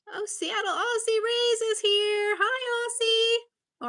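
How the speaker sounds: high, loud, delighted welcome; excited greeting. Real, not acted. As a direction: greet a new arrival with a burst of excitement. Greeting an arriving stream viewer.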